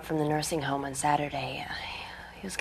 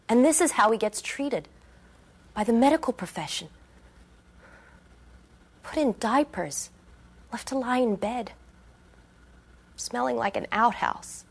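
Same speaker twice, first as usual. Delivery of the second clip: higher-pitched, wounded outrage; indignant; 6 semitones higher than usual. Acted. Resident recounting her dad's treatment in the nursing home, indignant. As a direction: list each indignity with rising outrage.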